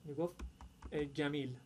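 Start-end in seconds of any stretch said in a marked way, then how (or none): 1.0-1.6 گمیل with jim turned into hard g; Cairene hard g. Retold or imitated. Teacher shows how a Cairo man spoke, hard g pronunciation. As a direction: imitate Cairo speech, گمیل as gamil.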